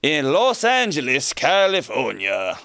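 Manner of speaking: a quick pirate-flavored "Tony", sung out high and stretched; pirate accent. Pretend. Host announcing the caller in a pirate accent. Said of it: "I don't even know what that accent was".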